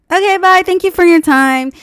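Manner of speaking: high, loud fake-polite goodbye; curt brush-off. Brushing off the salesman, mock-polite and loud. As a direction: sweetly dismiss him.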